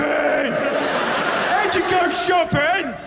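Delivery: incredulous. Real, not acted. Incredulous rant.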